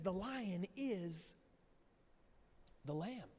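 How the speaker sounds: slow, hushed "The lion is ... the lamb" with a long pause before "the lamb"; dramatic pause. Retold or imitated. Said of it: "He pauses".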